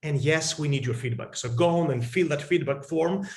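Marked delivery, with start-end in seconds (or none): none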